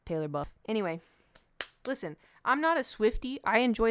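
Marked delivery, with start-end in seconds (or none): 0.1-0.5 Taylor pressed low and deliberate in "Taylor buff"; stress on Taylor. Shown as a demonstration. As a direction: repeat "Taylor buff" hitting Taylor, voice lower. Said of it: "You can call it what you want".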